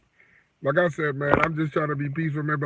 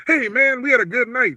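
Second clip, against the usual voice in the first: imitating cheery patrons; 6 semitones higher, 4 dB louder than usual. Bar patrons greeting the bouncer.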